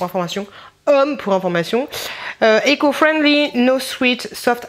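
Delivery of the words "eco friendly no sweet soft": reading the label like an American YouTuber, English accent. Real, not acted. Vlogger reading sock label in a mock American accent. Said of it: "parler comme une américaine".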